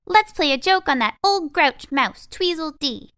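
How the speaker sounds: high, loud and eager; a cheeky little mouse plotting mischief; bright mouse voice. A narrator's reading. Mouse plotting a joke on grouchy Tweez, bright and high. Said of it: "said one of the mice brightly".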